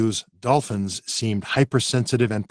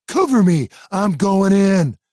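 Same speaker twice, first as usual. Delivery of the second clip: high, loud action-hero yell; heroic shout; 8 semitones higher, 6 dB louder, slower than usual. Acted. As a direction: charge in shouting. Jimmy charging in.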